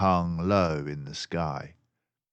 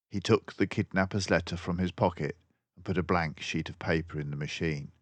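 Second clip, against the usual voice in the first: plain reading voice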